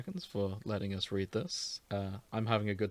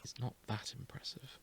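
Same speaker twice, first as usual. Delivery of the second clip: much softer sulky mutter; muttering Rivali; 14 dB quieter than usual. Acted. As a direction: grumble it under your breath. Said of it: "Rivali mutters".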